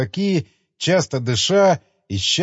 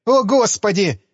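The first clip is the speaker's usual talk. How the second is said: terrified cry; 7 semitones higher, 3 dB louder than usual. A narrator's reading. Engineer terrified before collision.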